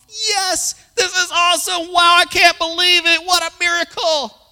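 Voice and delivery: very high, loud hammed-up celebration; ecstatic rejoicing. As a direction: squeal with over-the-top joy. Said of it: "The guy went off".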